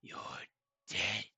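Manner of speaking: slow, sinister whisper; gloating villain. Acted. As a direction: whisper it as a victorious villain, drawing out each word. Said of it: "He gives you a grin".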